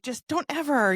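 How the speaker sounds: high, emphatic mock warning; not too stern. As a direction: imitate a mom's firm, concerned warning. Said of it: "too stern, but more like".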